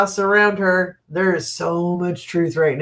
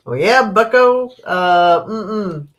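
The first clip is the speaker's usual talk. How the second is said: mock Happy Days taunt; 4 semitones higher, slower than usual. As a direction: tease them like a fifties TV tough guy. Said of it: "as they used to say on, what, Happy Days?".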